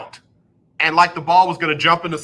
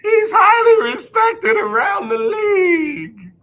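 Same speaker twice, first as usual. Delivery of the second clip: very high, slow, mock-wailing; exaggerated sarcasm; slower than usual. Shown as a demonstration. Showing fans how to praise Wentz, hammed-up mock cry. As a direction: stretch it out so nobody takes it seriously. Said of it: "You gotta say it like that".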